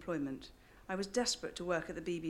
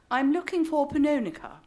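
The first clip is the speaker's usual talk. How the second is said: loud, higher, pleading English voice; desperate English tones; 7 semitones higher, 9 dB louder than usual. Retold or imitated. Young Englishwoman at a jazz club door, loud and desperate. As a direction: call it out a little desperately, very English. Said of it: "I said in my slightly desperate English tones".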